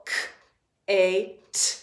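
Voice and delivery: "K, A, T" as crisp k, long a, crisp t; sounding out. Shown as a demonstration. Teacher giving the third syllable's sounds, sounding out. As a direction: separate the sounds of "K, A, T". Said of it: "Third syllable".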